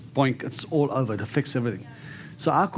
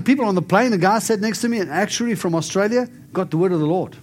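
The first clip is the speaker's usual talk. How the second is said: normal talking voice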